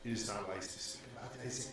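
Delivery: soft, low confidential voice; hushed warning. Acted. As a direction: lean in and warn softly. NPC leans in to warn.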